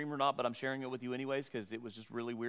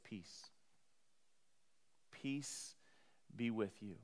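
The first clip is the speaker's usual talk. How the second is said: much softer, slow and tender; gentle peace; 5 semitones lower, 12 dB quieter, slower than usual. Acted. Jesus soothing his frightened disciples, softly and tenderly. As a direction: hush it like a calming blessing. Instead of blasting them.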